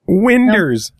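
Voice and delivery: high amused echo of "Winders"; repeating "Winders". Retold or imitated. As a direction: echo his "Winders" with a grin. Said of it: "in the Winders".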